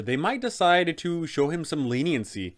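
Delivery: usual speaking voice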